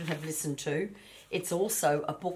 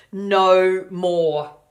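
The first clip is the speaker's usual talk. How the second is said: loud, drawn-out no more; firm loud refusal; 3 semitones higher, 13 dB louder than usual. Acted. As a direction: say it like a sick, done piglet. Child refusing.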